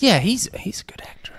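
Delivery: whispered aside, soft and breathy. Real, not acted. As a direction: say it in a hushed whisper.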